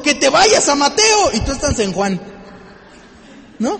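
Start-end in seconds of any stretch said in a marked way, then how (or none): 0.0-1.3 God calling. Retold or imitated. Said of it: "Tal vez Dios te está gritando".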